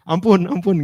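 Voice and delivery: high, loud begging for mercy; mock pleading. Caught sinner begging Samuel for mercy, high and loud.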